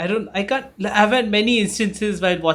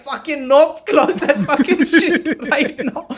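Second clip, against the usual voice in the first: higher, laughing, half-mock panic; panicked refusal; 8 semitones higher, slower than usual. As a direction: recoil from the screen, laughing in fright. Retelling fright at horror film.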